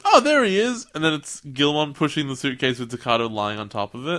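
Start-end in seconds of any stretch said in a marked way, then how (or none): 0.0-0.9 high, louder oh of discovery; mock surprise. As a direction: spot him suddenly. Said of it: "He goes".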